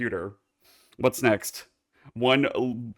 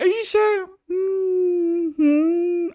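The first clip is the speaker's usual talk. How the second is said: mock menacing; 4 dB louder, much slower than usual.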